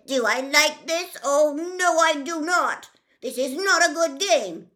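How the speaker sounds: high, loud, fussy fish; upset fish voice. Acted. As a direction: play a panicky little fish, loud and high.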